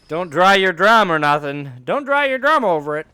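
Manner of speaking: high, loud, playful scolding; mock scold it loud and high. Playful riff, rising loud.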